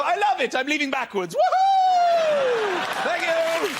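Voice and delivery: high squealing woohoo of joy; mock delighted cheer. Customer leaving the barber backwards, high excited cheer. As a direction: whoop like a thrilled customer.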